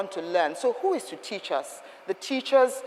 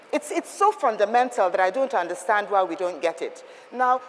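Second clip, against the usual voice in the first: ordinary talk, nothing marked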